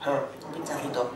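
very low, soft sceptical echo; mock-quiet echo. Comic echoing the nurse's little-jab promise, quiet disbelief. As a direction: repeat it slowly under your breath.

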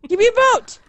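frantic demand. Real, not acted. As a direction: demand it desperately. Frantic for escape boat.

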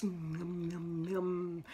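low smacking nom nom nom; munch it like a hungry crocodile; chomping noises. A narrator's reading. Crocodile smacking its lips over the pig: nom nom nom.

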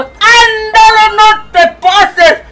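high, slow laugh-cry through the words; laugh-cry at a cheeky chat comment; teary laughing. Acted. Grandma laughing at a cheeky chat message, high and teary.

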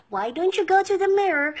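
usual speaking voice

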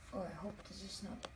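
usual speaking voice

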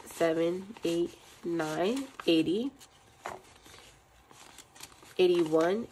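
ordinary talk, nothing marked

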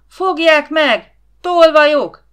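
high, piercing cry at full power; full-volume shout. A narrator's reading. Boy raising the alarm at intruders, shouting at the top of his voice. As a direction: yell it thin and high for help. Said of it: "kiabálta teljes hangerővel".

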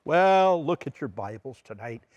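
normal talking voice

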